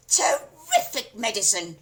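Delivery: put-on loud screechy old woman's cry; crone cry. A narrator's reading. Grandma praising the medicine, screechy cry. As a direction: cry out like a delighted hag. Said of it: "she cried".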